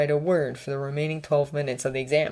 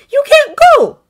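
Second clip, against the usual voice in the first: pleading no; 14 dB louder than usual. A narrator's reading. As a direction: stop him with alarm in the voice. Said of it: "he said".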